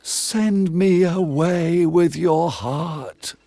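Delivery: high, slow, otherworldly plea; the soul begging the fisherman to keep it; pleading soul. A narrator's reading. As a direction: beg not to be sent away.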